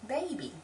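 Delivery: put-on "baby" carefully as BAY-bee; proper pronunciation. Shown as a demonstration. Speaker showing proper speech, careful "baby". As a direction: clean long A in "baby". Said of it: "if I'm speaking properly".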